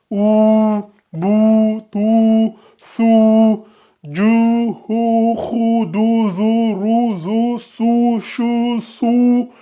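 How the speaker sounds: every "ou" drawn out on a steady note, as in bou, tou, sou; chant-like drill, holding the vowel of each syllable from ou to you; voyelle allongée. Shown as a demonstration. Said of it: "on l'allonge en deux secondes". (syllabes récitées avec le « ou » allongé)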